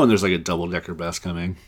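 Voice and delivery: ordinary delivery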